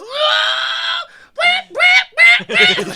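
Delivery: put-on high wailing wah wah, baby crying; mock wailing baby cry. Friend [name] mocking the baby name with a high wailing cry. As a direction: wail like a newborn, high and loud. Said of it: "[name] is goes".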